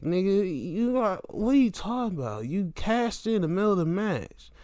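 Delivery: high incredulous voice; high mocking disbelief; mock Nick Wayne. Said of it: "And Nick Wayne, like".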